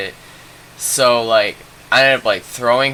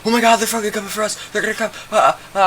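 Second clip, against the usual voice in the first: mock panic, high-pitched and rushed; freaking out; 11 semitones higher, much faster than usual. Friends hearing dogs approach, hammed-up freaking out. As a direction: gabble in a high, hammed-up fright. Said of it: "fucking freaking out".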